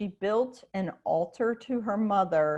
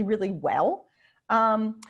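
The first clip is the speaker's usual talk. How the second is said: usual speaking voice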